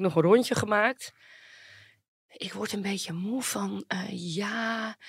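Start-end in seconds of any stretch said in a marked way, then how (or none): 2.4-5.0 a breathy parody of a tired star; mock weary whisper. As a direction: whisper it as a weary, self-absorbed artist. Mocking the artists' self-talk.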